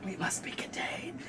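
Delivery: hushed conspiratorial whisper. Pretend. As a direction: breathe it like a secret. Mock conspiracy secret.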